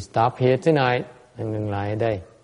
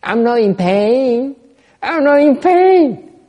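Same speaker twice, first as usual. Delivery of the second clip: higher, slow, louder sing-song "not in pain"; mock a deluded person insisting they feel nothing, building up; rising mock protest, louder and higher each time; mocking denial; 8 semitones higher, 7 dB louder, slower than usual. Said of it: "you just say".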